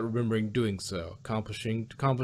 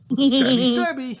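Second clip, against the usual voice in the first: high, loud, slow singsong "stabby, stabby"; playful mock menace; 11 semitones higher, 8 dB louder than usual. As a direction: ham it up like a gleeful little killer. Gleeful punchline about killing aliens.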